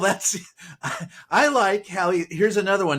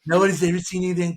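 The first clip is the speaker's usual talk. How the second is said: mock Trump; much faster than usual. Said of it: "No one's ever".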